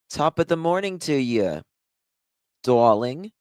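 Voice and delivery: a quick put-on Irish lilt; playful mock Irish accent. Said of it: "That's Irish".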